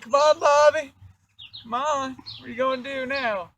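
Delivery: high, loud teasing call; taunting. Retold or imitated. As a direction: goad him, high and loud. Taunting Bobby.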